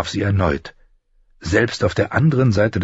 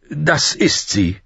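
slow, higher, emphatic affirmation; stout-hearted; 9 semitones higher, slower than usual. A narrator's reading. Older knight confirms the lady's loyalty, slow and stout-hearted. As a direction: affirm it boldly, word by word. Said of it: "erklärte der Mann beherzt".

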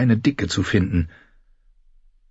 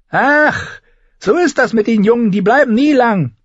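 much higher woman's voice calling down; 4 dB louder than usual. Acted. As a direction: shout down from the window. (hohe Frauenstimme, ruft herab)

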